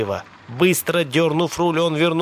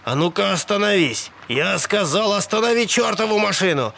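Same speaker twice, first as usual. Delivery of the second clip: agitated, higher-pitched order; insistent and exasperated; urgent demand; 6 semitones higher than usual. A narrator's reading. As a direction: demand it, voice rising with alarm. Alarmed passenger demands she stop.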